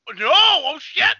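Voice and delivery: high, loud, slowed oh no; reliving a hype reaction. Retold or imitated. Telling his friend about Angemon's arrival, yelling in hype. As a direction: yell it as the hero shows up. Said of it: "I was like".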